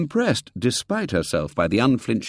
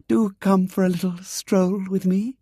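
sweet invitation; 12 semitones higher than usual. A narrator's reading. Girl invites with smile.

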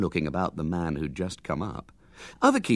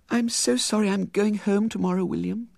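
put-on wistful girl; 12 semitones higher than usual. Girl sad to leave.

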